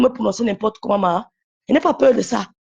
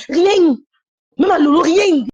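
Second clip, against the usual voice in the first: louder and higher, flat-out defiant; defiant loud outburst; 5 semitones higher, 5 dB louder, slower than usual. Real, not acted. To the woman mocking her in live streams, loud defiance. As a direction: raise the voice on "Rien", hammering it home.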